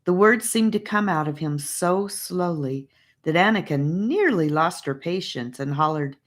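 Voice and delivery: usual speaking voice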